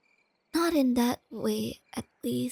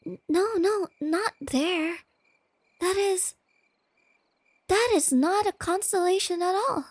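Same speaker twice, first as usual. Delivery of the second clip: flustered correction; 4 semitones higher, 6 dB louder than usual. Acted. Partner points at wrong stars.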